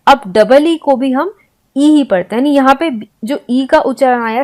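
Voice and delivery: ordinary delivery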